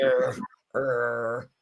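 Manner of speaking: a low playful growl, er, er; mock growl. Mom showing how the family growls at people, mock growl. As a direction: growl at strangers like a dog, half in fun. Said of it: "you know, growl at people".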